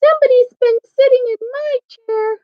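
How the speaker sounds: put-on very high, tiny Baby Bear; wee little voice. A narrator's reading. Baby Bear finds his chair was used, wee little voice. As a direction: pipe up like a little bear cub. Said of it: "in his wee little voice".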